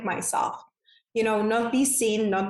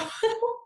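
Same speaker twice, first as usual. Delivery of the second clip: bright high giggle; a quick delighted laugh; 12 semitones higher, 4 dB quieter than usual. Real, not acted. Guest laughing at the host's compliment, a high giggle.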